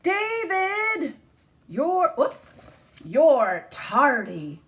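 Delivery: strict teacher. Acted. Teacher scolding late David.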